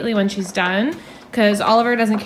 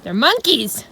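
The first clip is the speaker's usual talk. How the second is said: ordinary delivery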